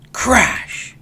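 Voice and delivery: loud, high crash; crash sound effect. A narrator's reading. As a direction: make the crash burst out. Shelf falls.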